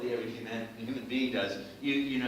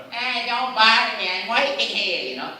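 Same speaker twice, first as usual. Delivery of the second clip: very loud, higher-pitched gruff shout; rough Brooklyn plumber; 7 semitones higher, 12 dB louder than usual. Acted. Plumber warning someone off while working, rough loud shout. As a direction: bellow like an irritated Brooklyn tough. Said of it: "a character that is rough and rough".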